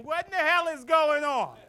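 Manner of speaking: high-pitched mock outcry; lady hollering on train. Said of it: "Some lady on the other side of the train".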